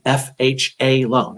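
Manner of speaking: F H A loan slowly, letter by letter; careful, slowed-down "F H A loan". Real, not acted. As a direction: space out each letter of "F H A loan". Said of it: "I gotta say that slowly".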